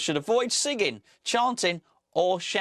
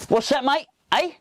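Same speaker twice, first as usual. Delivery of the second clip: mock mate asking; 10 semitones higher, 6 dB louder than usual.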